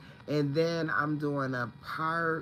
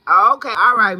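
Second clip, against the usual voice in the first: excited shout; 10 semitones higher, 16 dB louder than usual. Real, not acted. Greeting viewers on live stream.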